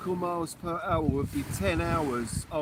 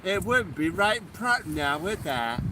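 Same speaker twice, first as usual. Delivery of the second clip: mock Somerset accent. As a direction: play a jolly Somerset yokel. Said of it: "Is that what they sound like in Somerset".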